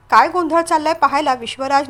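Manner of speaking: usual speaking voice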